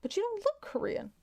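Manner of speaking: high-pitched mock puzzlement; puzzled doubter. Someone doubting the speaker's Korean link, puzzled. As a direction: imitate a doubter, pitch lifted well up. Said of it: "Korea wasn't the only one".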